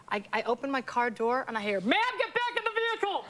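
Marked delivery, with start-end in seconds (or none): 1.8-3.2 much higher, barked cop order; police command voice. Retold or imitated. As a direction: bark it like a patrol cop ordering her back. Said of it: "that was good police voice".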